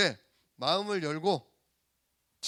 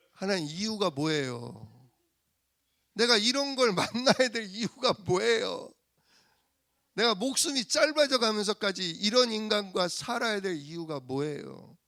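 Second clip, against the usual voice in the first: angry prayer; 3 semitones higher than usual. Acted. Wife demanding God's reason for such a man, acted exasperation. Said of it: "승질은 똑같이 내는데요".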